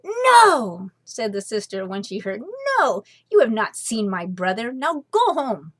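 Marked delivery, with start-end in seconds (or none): 0.0-0.9 high, loud refusal; firm no. A narrator's reading. Sister of the invisible being refusing, firm. 2.8-5.7 stern dismissal. A narrator's reading. Sister dismisses the liars.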